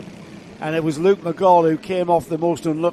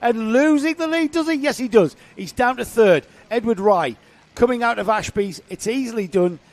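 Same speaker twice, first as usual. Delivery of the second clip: excited commentary; 6 semitones higher, 7 dB louder than usual. Acted. Live play-by-play of the race.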